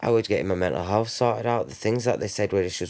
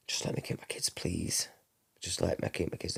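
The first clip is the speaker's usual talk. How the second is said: hushed, breath-only begging, much softer; tearful plea; 10 dB quieter than usual. Retold or imitated. Mother begging the judge to keep her kids, whispered tearful plea. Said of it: "And she's crying".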